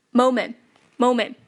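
moment as "momen"; dropped final t. Shown as a demonstration. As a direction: stop moment before the t sounds. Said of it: "这个t也可以不读出来了".

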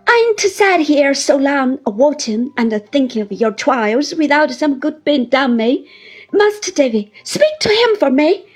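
put-on high, loud, crying old widow's plea; tearful pleading. A narrator's reading. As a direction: beg to be taken along, sobbing into it. Mrs Gummidge's long tearful plea.

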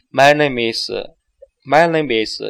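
slow, words run together; slow linked demo. Shown as a demonstration. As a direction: model the linking slowly. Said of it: "千万不要把它分开". (慢速连读示范)

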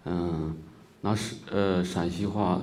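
normal talking voice

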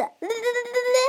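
a quick rattling lelele noise, like a frog knocked about; imitated croaking. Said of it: "像这样子".

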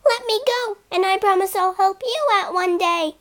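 high, pleading little mouse; squeaky mouse. Acted. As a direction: beg with a tiny voice.

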